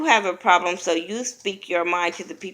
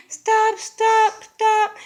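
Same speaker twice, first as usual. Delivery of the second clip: much higher and louder, repeated stop; urgent stop; 4 dB louder, much slower than usual. Retold or imitated. Jackie pleading during the fight, much higher and louder. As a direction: yell stop over and over.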